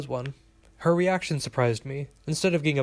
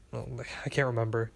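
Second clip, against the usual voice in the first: plain reading voice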